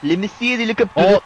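mock French accent on "Le Messi". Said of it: "Mira mi acento francés ahí".

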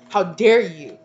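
loud, indignant outburst; mad ancestors. Acted. Ancestors rebuking an offender, loud and indignant. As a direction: fling it out in outrage. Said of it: "they are mad".

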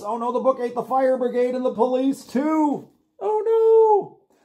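high, loud storybook shock; dramatic alarm. Acted. Storyteller shocked that the book ate the dog. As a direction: gasp it out high for the kids.

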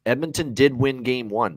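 ordinary talk, nothing marked

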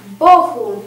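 loud burst of surprise; startled exclamation. A narrator's reading. Kniahini seeing an unwelcome guest arrive, startled exclamation. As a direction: exclaim it, taken aback. Zdziwienie, ale nieukontentowanie i przestrach.

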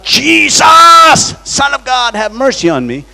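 loud, high desperate cry; blind man crying out. Retold or imitated. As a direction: cry out to Jesus from far off. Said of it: "began to cry out".

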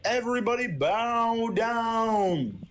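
slow, high, grandiose proclamation; mock prophet. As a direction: announce it like a prophet. Mock-solemn proclamation of a return.

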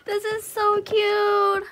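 high, slow, stretched-out gush; delighted cooing. Real, not acted. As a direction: coo over it, dragging the words out. Gushing over the gift.

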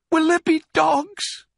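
slow, very high little voice; frightened child. A narrator's reading. Small fox dreading dogs, frightened child. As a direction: ask fearfully, drawn out and high.

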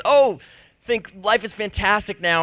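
high, loud burst of fake cheer; giddy self-mocking "oh, life is fantastic"; mock elation. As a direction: gush like everything's suddenly perfect. Mock elated thought while off pills.

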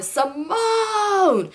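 high, stretched-out call of the name; mock scream. Grinning boyfriend calling out while she rants, high mock scream. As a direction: holler it teasingly in a high, long wail. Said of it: "smiling and screaming Simone".

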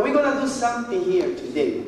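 normal talking voice

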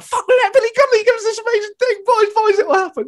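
very high, fast hammed-up squeal; giddy excitement. Fans in the immediate aftermath of the encounter, giddily excited. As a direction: gabble the whole thing in mock hysteria.

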